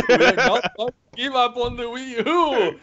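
high, sarcastic corporate spokesman voice; mock corporate promise. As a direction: parrot the promise in a high mocking voice. Mocking Nintendo's promise.

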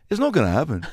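high, smiling brush-off in imitation. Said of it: "with a big [name] smile".

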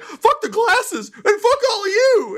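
very high, slow, squeaky yelled curse; furious urchin. Acted. Urchin cursing the laughing crowd before running off. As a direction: squeal your defiance at the whole crowd.

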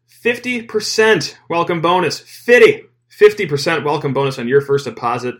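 FIFTY punched high and loud; hyped-up offer. Acted. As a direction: blast out "Fifty" like a big promo reveal. Hypes the offer after "get ready.

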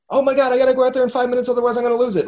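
high, fast frantic panic. Retold or imitated. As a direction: rush it out in a panic. Frantic partygoer voiced.

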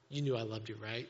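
low, hushed loving question; gentle, quiet check-in with a loved one; tender reassurance. Acted. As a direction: ask it softly and intimately. Imagined Jesus showing his scarred hands.

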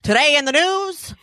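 high, loud newsreel voice; old radio announcer. Retold or imitated. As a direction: boom it out like a wartime newsreel. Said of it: "That's how it goes".

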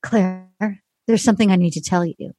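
low, grave mock TV host; imitating Chris Harrison. Host breaks news to waiting Claire, mock-solemn. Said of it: "he goes up and he goes".

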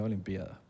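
soft, low "olimpiada" as o-lim-PÍ-a-da; "olimpiada" with I and A split; stress on the Í. Shown as a demonstration. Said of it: "se pronuncia olimpiada".

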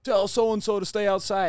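imitation of a play-caller's accent, pitched high and loud; Butts's accent. Player retelling a coach calling in a play, loud imitated accent. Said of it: "in his accent, and he's like".

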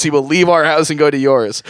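usual speaking voice

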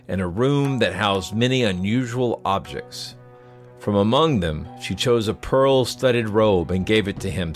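measured storyteller's read over music; story narration. A narrator's reading.

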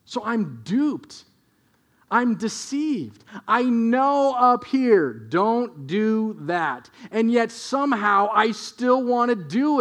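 slow, pressing preacher emphasis; emphatic preaching. Real, not acted. Preacher confessing inner struggle to congregation, slow emphatic delivery. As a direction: lean on every word slowly.

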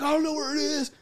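high-pitched helpless cry; mock panicked wail. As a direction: imitate someone flailing in the dark. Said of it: "He's like, he's like".

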